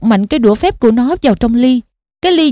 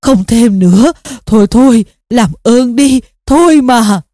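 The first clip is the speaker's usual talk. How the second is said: put-on louder, strained old man's plea; tiếng ré van xin; 3 dB louder than usual. A narrator's reading. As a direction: cry out, begging for it to stop. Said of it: "cụ lại ré lên lần nữa". (ré lên van xin)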